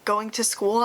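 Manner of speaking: ordinary delivery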